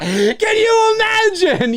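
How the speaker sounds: high, slow cry through laughter; laughing disbelief. Real, not acted. Host cracking up at his own intercom joke, laughing. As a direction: crack up while asking it.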